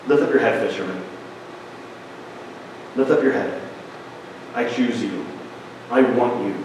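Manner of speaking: soft, low, tender reassurance; gentle Jesus. Retold or imitated. Jesus gently reassures the weeping fisherman, soft and tender. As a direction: lift him up softly, like a kind master.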